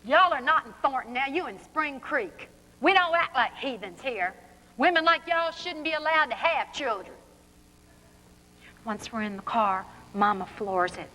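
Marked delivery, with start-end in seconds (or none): 0.0-7.2 higher-pitched mock scolding yell; yelling sheriff. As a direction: scold them as an indignant Southern sheriff. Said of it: "the sheriff's yelling at us".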